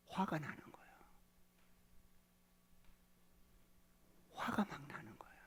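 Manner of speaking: hushed intense whisper. Real, not acted. Preacher whispering about Moses' anger.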